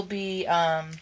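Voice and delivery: hoarse voice giving out; losing voice. Real, not acted. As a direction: talk through a failing, hoarse throat. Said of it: "I'm losing my voice today".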